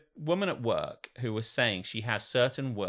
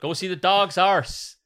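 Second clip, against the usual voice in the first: louder, high-pitched broad West Country; West Country accent; 8 semitones higher, 8 dB louder than usual. Acted. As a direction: push the West Country accent further, loud and comic. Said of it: "that was West Country".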